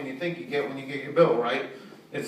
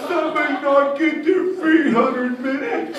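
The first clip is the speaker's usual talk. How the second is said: put-on high, slow, cooing baby talk to a customer; 10 semitones higher, 9 dB louder, slower than usual. Comedian mocks caller in baby talk. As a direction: coo at him like a toddler. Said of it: "I used baby talk".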